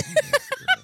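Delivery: very high, loud teasing squeal; shrieked mock cheer; squealed tease. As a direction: egg her on in a sky-high voice. Playful high squeal after whisper.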